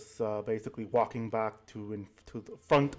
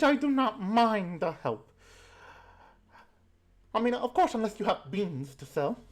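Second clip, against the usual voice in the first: put-on old granny voice; 10 semitones higher than usual. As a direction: coo like a doting grandma pinching cheeks. Granny offers help for beans.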